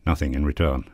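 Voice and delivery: ordinary delivery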